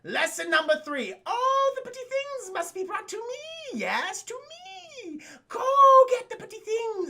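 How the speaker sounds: high, theatrical villain voice; shrill pompous sewer ruler; Sewer King voice. Retold or imitated. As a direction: hiss it like a creepy cult leader. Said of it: "And he's like".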